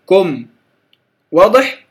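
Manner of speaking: normal talking voice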